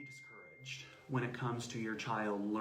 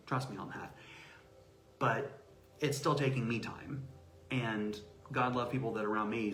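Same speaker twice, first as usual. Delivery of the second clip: ordinary delivery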